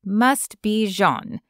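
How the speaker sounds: ordinary delivery, nothing marked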